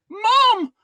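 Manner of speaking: loud, high cry of Mum; retold shout. Grown man recalls shouting for his mum. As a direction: yell it out for your mum like a child. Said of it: "I shouted".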